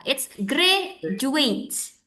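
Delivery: "graduate" drawn out very slowly, stress on the first syllable; slow model pronunciation. Shown as a demonstration. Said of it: "teacher models pronunciation".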